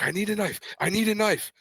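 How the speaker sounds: very high, frantic retold plea; high-pitched mock panic; out-of-breath urgency. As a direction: imitate an excited kid bursting in. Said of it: "with me being out of breath".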